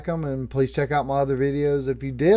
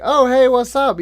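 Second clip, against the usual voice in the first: startled greeting; 8 semitones higher, 7 dB louder than usual. Retold or imitated. Startled greeting to a sudden stranger.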